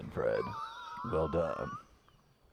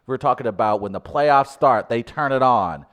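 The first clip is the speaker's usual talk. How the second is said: sports hype; 5 semitones higher, 10 dB louder than usual. Acted. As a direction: sell it like a playoff hype man. Comic sports-hype racism rant.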